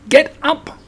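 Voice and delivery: very high squeaked order. A narrator's reading. Squashed Piglet to Pooh on top, squeaking. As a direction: squeal it from under the weight. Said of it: "squeaked Piglet".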